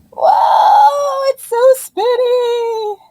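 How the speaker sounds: high, loud whoa then slow spinny; delighted teacher watching an egg spin; excited whoa. Real, not acted.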